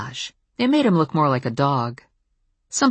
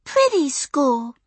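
put-on very high, slow small-child voice, falling at the end; slow, high preschooler statement; little girl repeating; 12 semitones higher, slower than usual. A narrator's reading. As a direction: insist on "Pretty school" as a tiny kid. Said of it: "repeated Maya".

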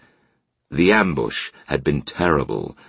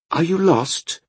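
put-on young woman's voice. A narrator's reading. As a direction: voice her gently and high. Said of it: "she asked".

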